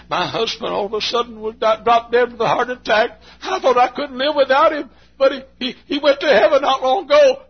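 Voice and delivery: high, loud widow's lament; grieving widow. Retold or imitated. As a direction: cry out the widow's loss up high. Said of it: "that person says".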